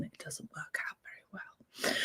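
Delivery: breathy whisper, nearly gone; whispered aside. Real, not acted. Telling viewers the plan fails, in a whispered aside. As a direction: drop to a secret whisper.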